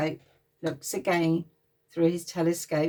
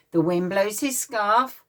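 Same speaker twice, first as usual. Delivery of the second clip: plain reading voice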